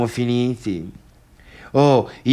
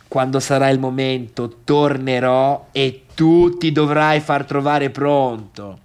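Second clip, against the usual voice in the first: ordinary delivery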